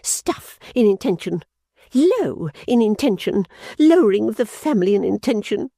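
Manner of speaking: Fanny ranting at her sister; scornful tirade. Acted. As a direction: pile up contempt on each phrase. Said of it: "the implacable Fanny struck in".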